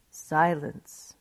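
usual speaking voice

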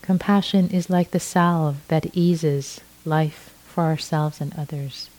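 ordinary talk

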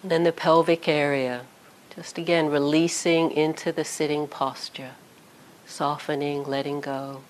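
calm guided body scan with long pauses; unhurried meditation voice, leaving space after each phrase. Real, not acted. Teacher guiding sitters through a body scan, calm meditation voice.

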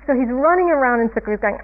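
ordinary delivery

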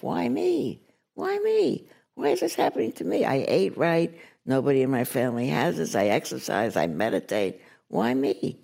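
high, fast, wailing why me; imitating her own panicked self-pity; whiny self-pity. [name] mimicking herself asking why me in panic. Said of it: "I'm saying to myself".

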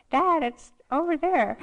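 put-on small kid piping up from the back seat; little kid voice. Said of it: "I reverted back to this little kid".

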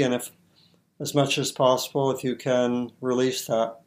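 usual speaking voice

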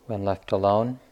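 ordinary talk

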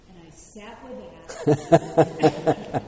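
exasperated punchline. Real, not acted. Student telling teacher her patience ran out, exasperated laughing drawl. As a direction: drag it out, fed up and laughing.

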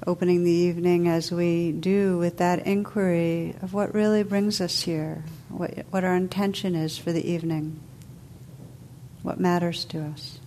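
calm, unhurried meditation voice with long pauses; guided meditation. Real, not acted. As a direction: guide listeners gently into stillness, letting phrases settle.

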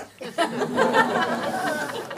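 big breathy laugh; delighted high laugh. Real, not acted. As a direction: burst out laughing at the line. Laugh after telling quote.

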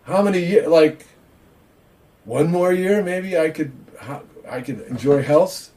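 a touch of mock alarm; mock despairing old man. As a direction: ham up the worried thoughts. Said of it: "they should be thinking".

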